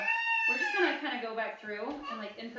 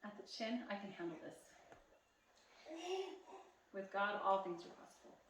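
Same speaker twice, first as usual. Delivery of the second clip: soft affirmations; 3 semitones higher, 7 dB quieter than usual. Shown as a demonstration. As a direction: murmur each affirmation gently to yourself. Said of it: "whisper this to yourself".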